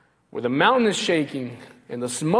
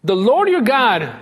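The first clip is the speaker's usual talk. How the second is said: louder, slower, each word driven home; emphatic proclamation; 5 semitones higher, 5 dB louder, slower than usual. Acted. Preacher thundering the divine name to the congregation. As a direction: proclaim it to the back row. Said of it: "pay attention ... phrase repeated".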